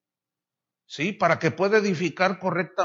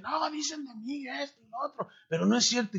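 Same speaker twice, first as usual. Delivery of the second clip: mocking hypocrite; 7 semitones higher than usual. Wolves denying accusations in their videos, mock whine. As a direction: mimic a false preacher playing innocent. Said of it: "Ya hacen videos y dicen".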